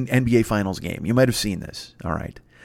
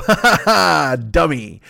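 mocking laugh; slower than usual. Someone laughing at an online bully, mocking laugh. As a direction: laugh right in their face. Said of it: "someone to just go".

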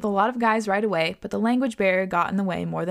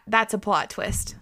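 usual speaking voice

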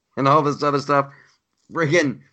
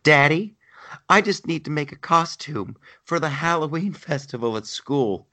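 mock little daughter; 6 semitones higher than usual. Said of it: "his daughter comes up to him".

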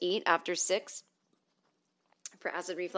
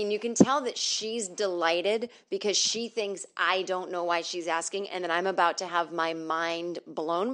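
ordinary delivery

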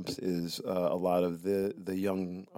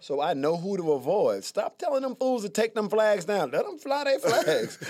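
mock defiance; 10 semitones higher, 6 dB louder than usual. Mock defiance about rebel flag.

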